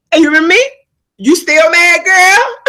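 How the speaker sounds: loud, high teasing call across the lot; mock loud greeting. Calling out to the woman who was mad, loud teasing. Petty greeting to old rival.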